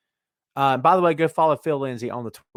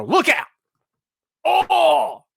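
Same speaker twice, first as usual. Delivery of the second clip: high, loud cry of hammed-up excitement; mock hype; 13 semitones higher, 5 dB louder, much slower than usual. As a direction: whoop it up like an overexcited fan. Mocking excited fans' hype.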